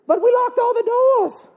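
very high, hammed-up Irish alarm; mock Irish accent. Family wonders how Nicholas got in, alarmed mock Irish accent. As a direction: protest in a squeaky Irish voice. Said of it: "I'm gonna get that accent".

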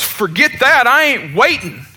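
imitated anger. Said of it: "he's getting madder by the minute".

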